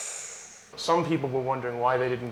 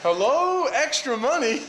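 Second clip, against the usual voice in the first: calling out; 9 semitones higher, 6 dB louder, slower than usual. Real, not acted. Calling out to someone in shop.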